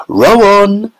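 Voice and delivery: high excited shout. A narrator's reading. Mister Wonka urging the Oompa Loompas on, shouting. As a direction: bellow it to the rowers. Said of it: "shouted Mister Wonka".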